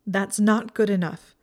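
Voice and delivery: loud, defiant objection; mutinous. A narrator's reading. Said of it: "folding her arms mutinously".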